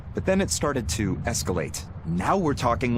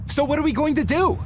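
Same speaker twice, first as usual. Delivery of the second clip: much higher, slightly louder worried question; anxious plea; 3 dB louder than usual. Acted. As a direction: beg for a plan.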